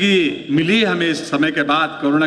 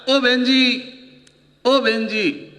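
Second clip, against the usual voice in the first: lightly voiced beggar calling at a door, higher; a half-hearted beggar's plea, pitched up; 5 semitones higher, much slower than usual. Comedian imitating a beggar calling to a housewife, a light mock beggar voice. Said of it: "भिखारी का performance ढंग से कर नहीं पा रहा हूँ".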